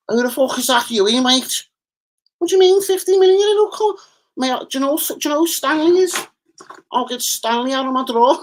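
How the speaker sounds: very high, aggrieved Scouse voice; hammed-up Scouse impression; Toxteth accent. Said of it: "shouts out to my Toxteth brother".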